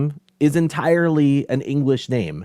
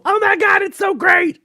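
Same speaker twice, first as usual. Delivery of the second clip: high-pitched shouted mock gushing; gushing fan imitation; 5 dB louder than usual. Mocking fans who overpraise the film, gushing. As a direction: squeal it like an overexcited fan. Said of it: "when people go".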